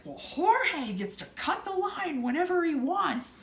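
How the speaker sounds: lightly voiced smug customer; mocking customer. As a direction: sneer it like an entitled jerk. Quoting rude customer.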